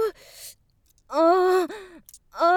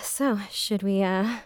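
spent afterglow; 7 semitones lower, 5 dB quieter than usual. Acted. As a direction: lower and softer, a sated purr. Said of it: "I can't trust my legs yet".

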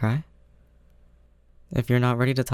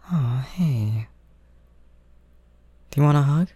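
ordinary delivery

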